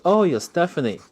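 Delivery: play-acting amazement; mock surprise. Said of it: "and he goes".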